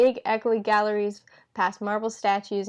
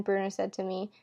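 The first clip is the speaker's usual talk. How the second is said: ordinary delivery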